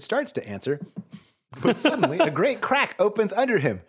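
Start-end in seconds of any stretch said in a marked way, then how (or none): none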